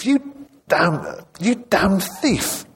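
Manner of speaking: high, drawn-out indignant outburst; acted outrage, without extra volume; angry accusation. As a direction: accuse the thief, slowly and pitched well up. Van Cleef seizes and accuses.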